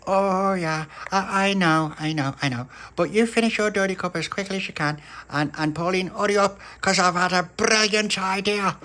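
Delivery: put-on a boy bursting with an idea; excited little boy. Little pappy hurrying Pauline along, lightly excited.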